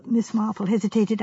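usual speaking voice

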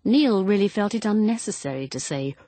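usual speaking voice

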